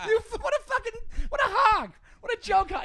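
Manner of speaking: high, loud mock indignant shout; laughing outrage. Real, not acted. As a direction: yell it while cracking up. Joke stolen, mock outrage.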